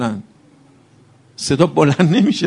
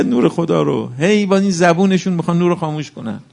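ordinary delivery

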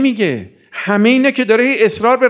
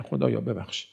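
a much softer murmured plea; hushed aside; 8 semitones lower, 13 dB quieter than usual. Real, not acted. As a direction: drop the voice to ask pardon. Preacher's aside asking forgiveness.

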